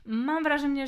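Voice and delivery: normal talking voice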